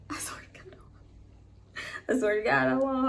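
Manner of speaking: real voice giving way to a whisper, then pitching up; fighting back tears. Real, not acted. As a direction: choke it back while trying not to cry. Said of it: "I'm not gonna cry in this video".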